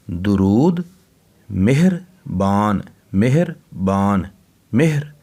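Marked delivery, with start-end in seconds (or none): 1.5-4.3 मेहरबान said slowly, syllable by syllable, with a silent ह after मे; careful slow spelling-out. Shown as a demonstration. Teacher sounding out मेहरबान slowly for children. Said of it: "हे जो है ना, ये साकिन है".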